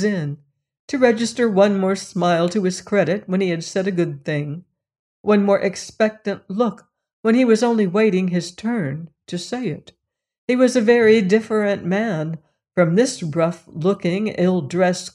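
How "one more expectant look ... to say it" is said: usual speaking voice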